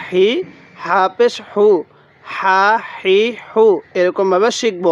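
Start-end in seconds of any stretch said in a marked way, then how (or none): 2.2-3.6 হা হি হু with a puff of breath; Arabic ha, breathy; airy ha. Shown as a demonstration. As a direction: breathe out lightly on হা, হি, হু. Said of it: "মুখ দিয়ে হালকা বাতাস বের করব".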